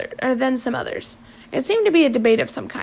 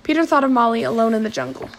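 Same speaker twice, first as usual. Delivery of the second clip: plain reading voice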